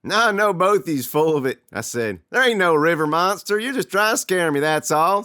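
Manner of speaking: high, loud and defiant; defiant shout. Acted. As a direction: call their bluff loudly. Kid accuses brothers of prank.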